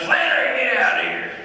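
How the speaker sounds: loud, high granddad holler. Retold or imitated. Granddad on the porch yells for his grandchild, imitated holler. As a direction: holler like a granddad summoning a kid. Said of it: "He taught me some of the coolest shit".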